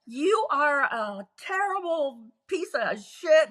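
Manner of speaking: neighbor yelling. Retold or imitated. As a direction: tell him off from over the fence. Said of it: "she's yelling back at him".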